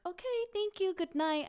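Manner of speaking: high singsong goodnight; lightly acted, high-pitched polite goodbye; polite brush-off. Woman to her date at the door, polite high brush-off.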